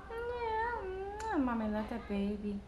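soft, slow tender coo with a catch. Real, not acted. Mother telling her baby she loves him, soft and slow.

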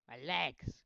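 loud cry over the legs; shout. Real, not acted. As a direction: yell in comic dismay. Character's legs break.

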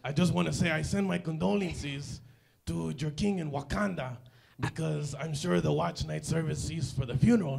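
usual speaking voice

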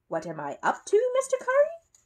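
stalling, pitch leaping up on the name; playing for time. A narrator's reading. Paddington caught by Mr Curry, playing for time. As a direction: echo the question to stall, rising high at the end. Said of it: "playing for time".

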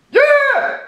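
piercing very high scream; shrill high scream. Shown as a demonstration. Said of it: "nobody can do that".

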